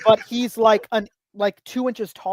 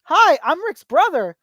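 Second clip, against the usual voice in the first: imitated twelve-year-old, high and slow; little brother's voice; 7 semitones higher, 5 dB louder, slower than usual. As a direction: chirp it like a kid introducing himself. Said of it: "you do the voice".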